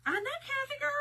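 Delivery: ordinary talk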